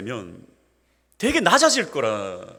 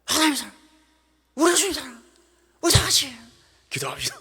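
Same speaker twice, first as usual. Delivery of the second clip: much higher Gyeongsang preacher in fragments; Gyeongsang dialect preaching; 14 semitones higher than usual. Retold or imitated. Pastor imitating his unfinished Gyeongsang sentences, high. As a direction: chop the sermon into Gyeongsang bits. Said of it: "저도 설교가".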